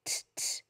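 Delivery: "t, t" as light unvoiced taps; crisp whispered "t" sounds; voiceless t sound. Shown as a demonstration. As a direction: tap out the "t" with no voice. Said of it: "you'll say the sound t".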